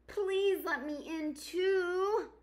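high, slow pleading fox; begging fox voice. Acted. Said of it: "begged Fox".

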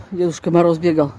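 usual speaking voice

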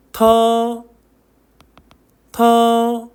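터 held long, aspirated t; sustained 터 with a puff at the onset; pronunciation drill. Shown as a demonstration. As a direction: model 터 on a high level tone. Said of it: "한 번씩 읽어볼까요?".

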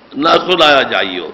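ordinary talk, nothing marked

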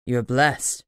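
a hint of an old tree spirit, low and slow; low dryad voice. Ancient dryad speaks.

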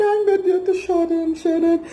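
high, hammed-up whimper; mock crying. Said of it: "A pessoa fica machucada". (choro debochado e exagerado, voz aguda)

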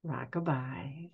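soft, slow hushed whisper. Acted. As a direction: breathe it out like the sea. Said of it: "whispered the sea".

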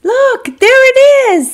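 very high, loud eager shout; excited monkey. Acted. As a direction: point and cry out happily. Monkey spots something.

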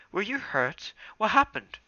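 high, eager questioning. Acted. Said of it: "Smudge prompted in eagerly".

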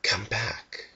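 breathy, voiceless whisper of the trees. A narrator's reading. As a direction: whisper it like the forest calling him home. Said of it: "The trees seemed to whisper to him".

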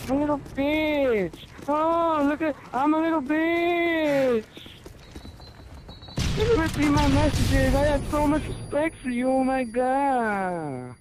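whiny crybaby impression. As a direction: squeal it like a spoiled brat throwing a tantrum. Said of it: "I whine and bitch and complain".

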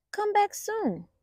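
high, small pleading voice; littlest bunny. Acted. Littlest bunny asks Titu to come back, in a high small voice. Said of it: "It was the littlest bunny".

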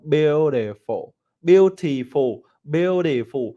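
teacher drill; model "beautiful". Shown as a demonstration. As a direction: repeat it for the class. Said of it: "Nào".

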